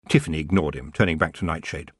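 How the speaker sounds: ordinary delivery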